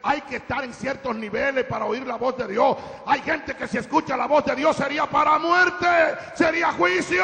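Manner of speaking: loud, rising pulpit shout; full-force preaching. Real, not acted. Preacher warns that hearing God could mean judgment, full-force preaching.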